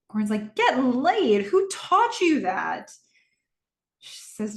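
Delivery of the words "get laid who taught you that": high, loud, drawn-out disbelief; retold parental shock, pitched up; mock shocked dad. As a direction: act out a scandalized father. Acts out dad shocked at daughter.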